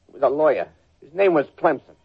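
ordinary talk

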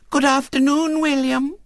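put-on very high, loud little-girl greeting; piping, lisping small girl; Violet Elizabeth. A narrator's reading. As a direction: sing out a prim childish hello. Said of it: "said Violet Elizabeth".

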